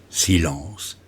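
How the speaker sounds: ordinary delivery, nothing marked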